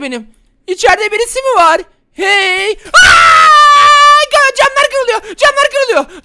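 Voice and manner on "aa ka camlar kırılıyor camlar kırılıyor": high loud frightened cries; panicked scream. Acted. Windows breaking around him, panicked screaming. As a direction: panic as glass shatters.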